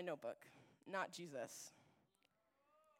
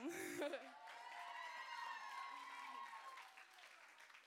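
shrieking laugh; 8 dB quieter than usual. Real, not acted. Big laugh after "here I am.

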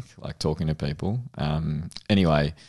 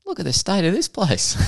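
burly Aussie bloke; 12 semitones higher than usual. Retold or imitated. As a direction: ham up a disgusted Aussie tradie. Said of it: "a big, burly Aussie bloke".

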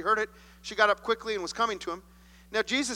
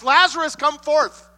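booming command, higher and louder; loud cry; 7 semitones higher, 11 dB louder, slower than usual. Acted. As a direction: call the dead man out of the tomb. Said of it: "he cried out with a loud voice".

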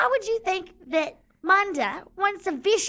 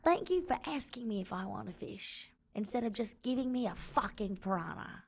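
much softer, lower thanks; soft lower thanks with a laugh; quiet gratitude; 4 semitones lower, 8 dB quieter than usual. Acted. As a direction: murmur it sincerely with a grin. Grateful, sheepish reply to Chuck.

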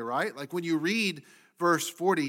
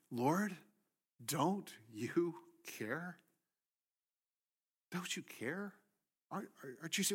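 quiet plea; 9 dB quieter than usual. Acted. Martha quietly pleading.

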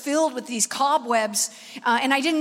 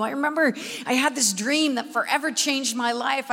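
usual speaking voice

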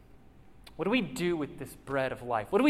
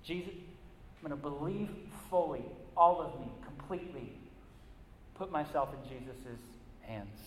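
fading from firm resolve to a hushed, softer prayer; sincere surrender; 6 dB quieter than usual. Retold or imitated. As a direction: give yourself over, quieter with each phrase.

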